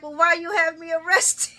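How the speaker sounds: high, loud mock-indignant question; playfully outraged retelling. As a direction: imitate an affronted demand, voice jumping up. Acting Sonny's indignant question to Dante.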